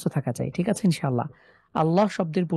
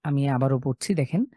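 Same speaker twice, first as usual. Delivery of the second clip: usual speaking voice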